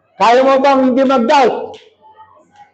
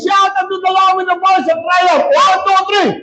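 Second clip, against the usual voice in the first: high rallying shout with a count-in; demonstrated shout; 4 semitones higher than usual. Shown as a demonstration. Preacher showing the church how to say it, high shout. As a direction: shout the line high to lead the crowd. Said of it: "say like this".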